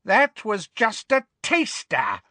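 high, gloating villainess; Bellatrix. A narrator's reading. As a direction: taunt in a high put-on voice. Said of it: "said Bellatrix".

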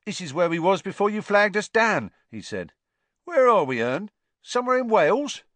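high, loud gleeful boasting; cockney lad. A narrator's reading. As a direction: show off to the newcomer. Stan showing off.

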